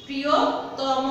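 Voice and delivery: প্রিয়তম said slowly, the final syllable ending in o, priyotomo; teacher demonstrates প্রিয়তম slowly with its o ending; o ending pronunciation. Shown as a demonstration. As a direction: stretch প্রিয়তম out and close it with an o. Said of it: "ওকার দিয়ে আমরা উচ্চারিত করি".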